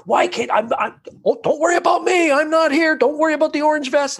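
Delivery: bitterly mock the drivers who ignore him; sarcastic rant at trucks. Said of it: "I'm swearing at every truck that goes by".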